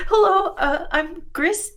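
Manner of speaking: high-pitched, hesitant introduction; stammering. Acted. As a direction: awkwardly offer a greeting, with a filler. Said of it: "then kind of stammers as".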